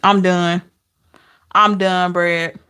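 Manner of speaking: normal talking voice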